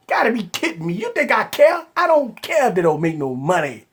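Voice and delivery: normal talking voice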